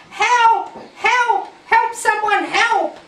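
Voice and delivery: high, loud calls for help; panicked cries for help. Acted. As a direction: cry out for help as a runaway engine. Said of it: "came through the yard crying".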